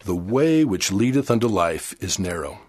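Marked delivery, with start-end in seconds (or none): none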